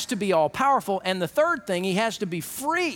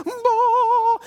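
sudden loud high "Bah"; loud high exclamation; burst of glory; 13 semitones higher, 5 dB louder than usual. Acted. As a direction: blast it out like a sudden flash. Jesus glorified on them.

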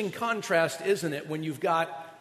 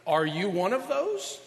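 ordinary talk

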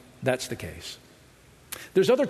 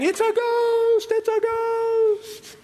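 high, slow, wailing ghost panic; hammed-up frightened cry, drawn out high; mock terrified shriek; much slower than usual. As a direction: squeal it out like a spooked sailor. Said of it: "They all freak out saying".

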